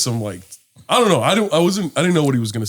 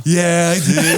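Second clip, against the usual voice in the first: mock stoned voice; put-on stoner agreement; stoned reply; 3 semitones higher, much slower than usual. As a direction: answer as if happily stoned. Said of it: "All stoned".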